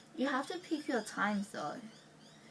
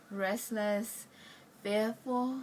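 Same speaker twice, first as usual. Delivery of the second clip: ordinary talk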